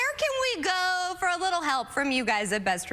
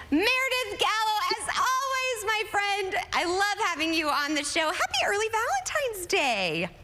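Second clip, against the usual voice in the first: excited; 7 semitones higher than usual. Real, not acted. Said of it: "I'm very excited".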